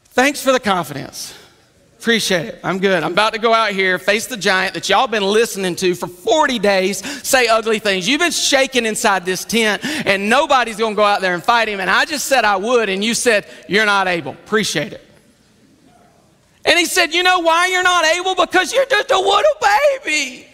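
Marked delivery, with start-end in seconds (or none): 0.1-8.1 low, deadpan David; dry, lowered voice, unimpressed; sarcastic thanks. Acted. David answers Saul's put-down. 8.1-15.1 low, deadpan grievance; lowered, unimpressed David voice; sarcastic comeback. Acted. David's sarcastic reply to Saul. 17.1-20.5 high, condescending taunt; pitched-up babyish put-down; mocking baby talk. Saul mocks David as a baby.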